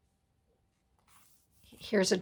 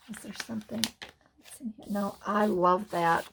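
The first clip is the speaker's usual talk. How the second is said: ordinary delivery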